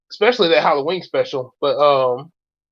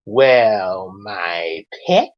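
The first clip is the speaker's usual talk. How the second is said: a lightly voiced Mal, lower and purring; Mal's voice; 8 semitones lower, much slower than usual. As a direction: drop low and coo the pet name like Mal. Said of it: "in Mal's case, it's".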